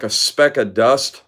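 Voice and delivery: normal talking voice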